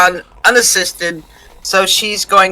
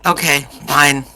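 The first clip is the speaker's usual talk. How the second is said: grudging sigh; 5 semitones lower, 3 dB quieter than usual. Acted. Reluctant agreement.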